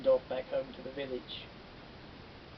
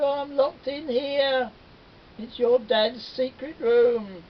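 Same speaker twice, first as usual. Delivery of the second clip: high, slow, loud wail; whining trapped spider; pleading spider; 10 semitones higher, 8 dB louder, slower than usual. Acted. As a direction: plead to be let out.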